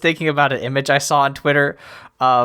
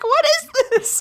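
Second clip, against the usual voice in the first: mock disbelief. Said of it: "And I was just like".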